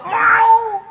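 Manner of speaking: a mother's high, loud eager yes; high excited shout of yes. Retold or imitated. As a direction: blurt it out like a thrilled mother. Mother eagerly agreeing in story.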